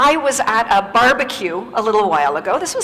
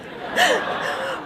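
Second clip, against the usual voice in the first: high unvoiced burst of laughter; big laugh; 3 dB quieter than usual. Real, not acted. Laughing at the punchline of a story.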